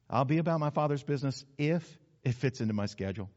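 low, soft conditional promise; noncommittal. Shown as a demonstration. Preacher showing lukewarm commitment, soft low hedging. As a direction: hedge quietly around "if". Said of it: "Or maybe it's like this".

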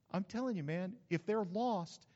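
normal talking voice

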